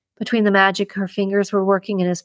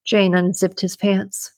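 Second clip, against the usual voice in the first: plain reading voice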